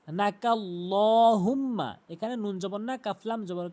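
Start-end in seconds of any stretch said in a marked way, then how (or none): 0.1-1.9 নাকাল্লাহুম্মা slow, with a rounded, heavy "law"; heavy long L. Shown as a demonstration. Teacher modelling the word, slow heavy-L নাকাল্লাহুম্মা. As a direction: draw out the dark L and held m in নাকাল্লাহুম্মা. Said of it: "ল বলতে হবে".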